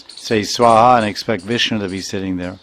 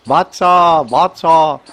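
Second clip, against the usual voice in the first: higher, louder drawn-out call to a calf; mother cow calling; 5 semitones higher, 4 dB louder, slower than usual. Retold or imitated. Mother cow calling her calf in the pasture, high long call. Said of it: "the mother cow calling her baby".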